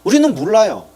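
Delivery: ordinary delivery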